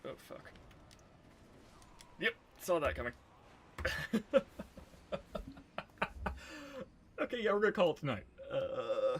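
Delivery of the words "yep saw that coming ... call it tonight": fast and loud through laughter; laughing. Real, not acted. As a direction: blurt it out laughing. Laughing after death.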